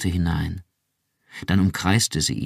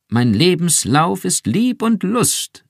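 gepresst, viel höher und lauter, langsam; gepresste Stimme; 12 semitones higher, 6 dB louder, slower than usual. Acted. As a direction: ein altes Lied gepresst hervorstoßen. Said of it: "mit seltsam gepresster Stimme". (Iran zitiert bewegt ein altes Lied, gepresst)

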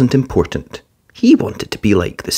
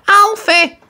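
high, loud, breathless; out of breath; 4 dB louder than usual. Acted. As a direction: gasp his name. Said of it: "she puffed".

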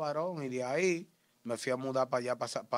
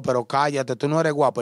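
louder, telling him to shut up; loud scolding; 3 semitones higher, 12 dB louder than usual. Retold or imitated. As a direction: snap at the screaming man. Calla al que grita. (regaño fuerte)

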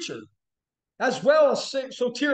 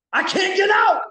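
mock struggling shout; 8 semitones higher, 6 dB louder than usual. Acts out being held fast.

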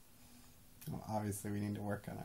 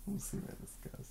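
laughing whisper; 9 semitones higher, 5 dB quieter than usual. Real, not acted.

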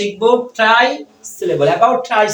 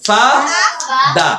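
"ফা" and "দা" as two separate beats; split into syllables; 5 semitones higher than usual. Shown as a demonstration. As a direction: say "ফা", pause, then "দা". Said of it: "কয়বার হলো?".

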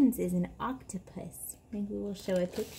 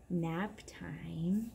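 whispered; 4 semitones lower, 7 dB quieter than usual. Acted. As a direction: whisper the words softly. Settling child for nap.

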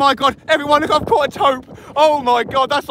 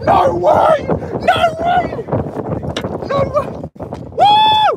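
joyful shouting. Real, not acted. Angler whooping to the crew as the tope lands on deck, elated. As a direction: scream it out in triumph.

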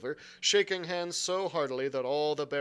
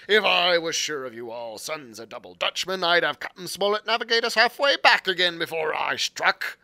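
put-on louder pirate brogue, boastful old sea cook; Long John Silver; 9 dB louder than usual. A narrator's reading. Silver boasting to the lad about Flint's crew, pirate brogue. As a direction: swagger it like a one-legged pirate cook. Silver explains his plan.

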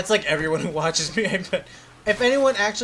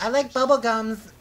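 slow, higher-pitched goofy delivery; silly drawn-out line; 4 semitones higher than usual. Pretend. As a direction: draw it out, pitched up, for a laugh. Says a silly line, then repeats it.

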